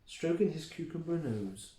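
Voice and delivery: ordinary delivery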